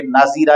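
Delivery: नाजीरा with "i" in place of "a"; wrong middle vowel. Shown as a demonstration. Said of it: "नहीं पढ़े".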